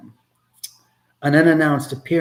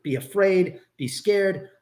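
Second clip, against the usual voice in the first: ordinary talk, nothing marked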